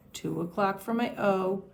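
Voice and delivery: ordinary talk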